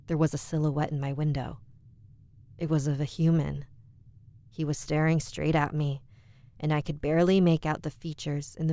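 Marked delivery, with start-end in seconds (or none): none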